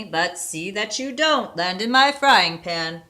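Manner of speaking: ordinary delivery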